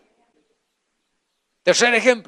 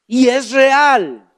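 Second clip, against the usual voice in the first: ordinary talk, nothing marked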